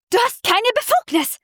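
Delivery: indignant, high-pitched accusation; angry outburst. Acted. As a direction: she flares up at her rival over her design. Slams folder down angrily.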